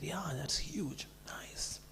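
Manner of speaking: slow, soft awed whisper; nervous whisper. Retold or imitated. Guest eyeing the huge bodyguard, nervous whisper. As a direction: breathe it out, wary of the giant.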